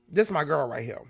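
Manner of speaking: ordinary delivery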